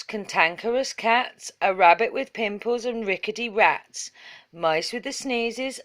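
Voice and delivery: ordinary delivery, nothing marked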